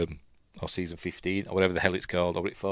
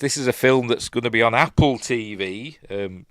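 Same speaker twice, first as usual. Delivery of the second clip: ordinary talk, nothing marked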